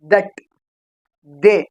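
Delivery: "ದಟ್", "ದೇ" opening on a voiced dental d. Shown as a demonstration. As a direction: model "ದಟ್", "ದೇ" one by one with a Kannada da. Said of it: "ದ ತರ pronunciation ಆಗ್ತದೆ". ("ದಟ್", "ದೇ" ದ ಧ್ವನಿಯಿಂದ ಹೇಳಿ)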